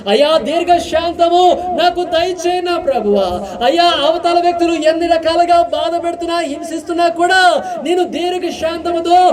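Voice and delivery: high, ringing prayer voice; impassioned pulpit prayer; fervent prayer. Acted. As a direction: cry out to God for the congregation.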